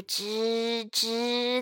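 long drawn-out creak of 吱吱; creaking rope. A narrator's reading. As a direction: stretch 吱吱 like a straining rope. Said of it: "它开始发出吱吱的声音". (拖长模仿绳子吱吱声)

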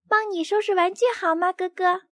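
put-on high quick eager child; high little girl voice. A narrator's reading. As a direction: offer brightly like a small girl. Sister cub offers help.

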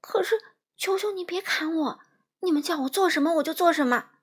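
high, quavering plea that speeds up; frightened pleading. A narrator's reading. Little man facing the axe, frightened and begging. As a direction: beg for mercy, hurrying at the end. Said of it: "小个子男人声音发抖地说".

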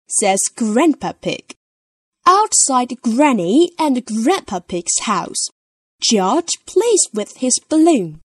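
usual speaking voice

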